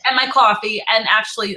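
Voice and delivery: normal talking voice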